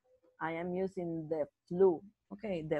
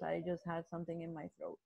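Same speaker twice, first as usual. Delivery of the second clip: normal talking voice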